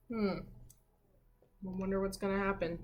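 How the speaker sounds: ordinary delivery, nothing marked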